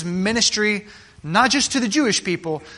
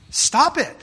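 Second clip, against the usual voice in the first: put-on higher child's protest; mock small boy telling dad to stop; lightly voiced little boy saying stop it; imitating his son; 7 semitones higher than usual.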